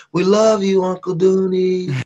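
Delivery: a hint of little kids cheering their uncle; young competitors greeting their uncle fondly in a light kid voice; kids calling out. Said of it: "they always call me Uncle Dooney".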